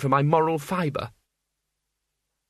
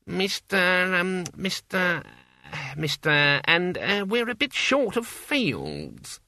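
high, slow, faltering official; officious clerk; 7 semitones higher, much slower than usual. A narrator's reading. Clerk turns Death down, high-pitched and hesitant. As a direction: stumble on the name, then condescend slowly.

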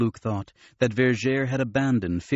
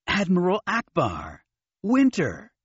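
excited greeting; 7 semitones higher than usual. Acted. Joyful reunion with old friends.

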